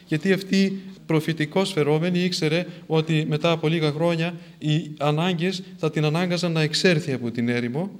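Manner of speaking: ordinary talk, nothing marked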